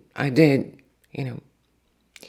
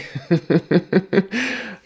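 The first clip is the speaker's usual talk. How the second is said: rapid giggling run, pitched up; flustered laugh; 6 semitones higher than usual. Real, not acted. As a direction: laugh off your own mix-up. Flustered at getting names mixed up.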